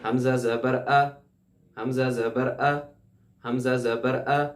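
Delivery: slow ا let go, not jerked back; slow drill, ا released; correct hamza. Shown as a demonstration. Teacher leads students repeating the correct sound. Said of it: "آواز کو چھوڑ دینا، بس واپس نہیں کھینچنا".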